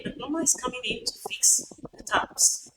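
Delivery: ordinary delivery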